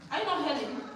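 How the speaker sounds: usual speaking voice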